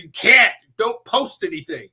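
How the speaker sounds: high, emphatic, loud "can't"; urgent warning. Retold or imitated. Husband warning wife not to post, loud and urgent. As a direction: forbid it with sudden alarm.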